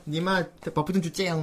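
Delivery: lightly imitated cute girl gamer; soft, lisped pleading, lightly put on; cutesy baby talk. Host imitates a girl begging for buffs, cutesy. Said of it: "말투도 막 귀엽게 했어요".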